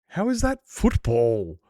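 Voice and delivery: slow, loud, disbelieving; incredulous. Real, not acted. Streamer rants at chat about rugby, loud and slowly incredulous. Ranting about NFL being called football.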